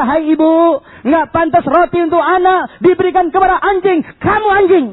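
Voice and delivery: high, loud declamation; thundered rebuke rising to the last words; Jesus. Retold or imitated. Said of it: "Waktu Yesus berkata".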